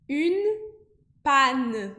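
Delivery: panne with an open pa and no nasal; French une panne. Shown as a demonstration. Teacher modeling une panne in French. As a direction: say panne like pá de panela. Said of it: "Não vou falar pan. É panne".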